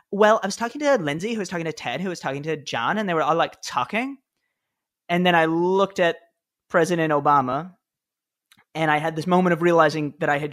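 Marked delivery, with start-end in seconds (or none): 0.1-4.2 put-on a light Valley Girl lilt, pitched up, with talking rising at the end; Valley Girl accent. Speechwriter showing the president the joke, in a light Valley Girl accent. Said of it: "say it in, like, a Valley Girl accent".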